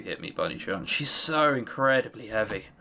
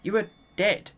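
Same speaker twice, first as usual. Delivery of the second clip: trembling, shaken voice; shaky voice; 6 semitones higher than usual. Acted. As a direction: let the voice shake. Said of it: "Her voice is shaky".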